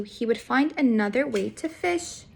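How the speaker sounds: plain reading voice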